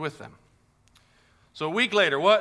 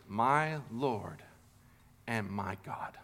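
soft, lower awed confession; Thomas in awe; 4 semitones lower, 6 dB quieter, much slower than usual. Retold or imitated. Thomas awed before Jesus, hushed. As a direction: say it hushed, overwhelmed. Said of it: "He goes".

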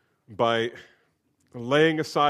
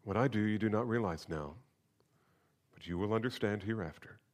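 scripture reading. Acted. Pastor reading the gospel to the congregation, solemn. Reads the gospel passage aloud.

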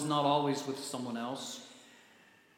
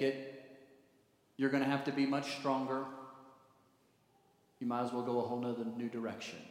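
advising counselor; 4 semitones lower than usual. Acted. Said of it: "counselors that you've gone to see have given you direction".

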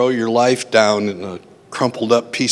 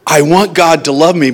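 normal talking voice

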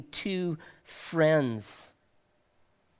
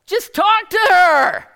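imitated friend's shout; 11 semitones higher, 11 dB louder than usual. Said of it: "He's going".

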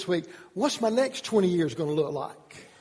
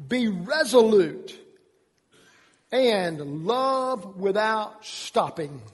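emphatic scripture reading; much slower than usual. Acted. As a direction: read the verse like a charge to the church.

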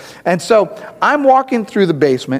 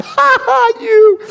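much higher, mocking ha ha; mocking laugh; 4 dB louder, much slower than usual. Wife imagined mocking his hurt toe, laughing. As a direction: cackle at him in mock glee. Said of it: "she could have just gone".

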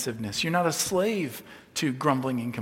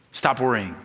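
stern command; 3 semitones lower, 4 dB louder than usual. Acted. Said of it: "he's not heaping another law".

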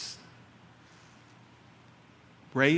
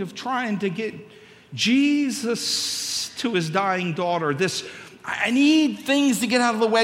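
higher, louder urgent pleading; urgent inner voice; 9 semitones higher, 5 dB louder than usual. Retold or imitated. Preacher voicing his own urgent panic. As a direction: press it like a desperate father. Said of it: "I'm like".

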